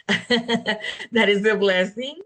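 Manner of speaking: laughing warmly through the words. Real, not acted. Guest thanking her coach, laughing as she speaks. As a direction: let a happy laugh carry the line.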